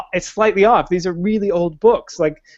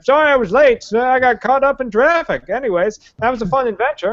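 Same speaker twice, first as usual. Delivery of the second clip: breezy fake-sorry wizard; shrugging off being late; mock Gandalf; 7 semitones higher than usual.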